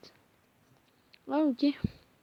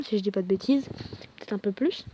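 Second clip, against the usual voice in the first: ordinary talk, nothing marked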